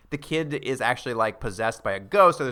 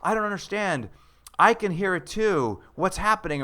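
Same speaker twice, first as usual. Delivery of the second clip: higher-pitched, mildly panicked mother; freaking-out mom; 7 semitones higher than usual. Retold or imitated. Retelling the mom hiding from the dead man, mock panic. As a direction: lightly act out a bewildered, frightened mum. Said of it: "they're freaking out. And the mom's".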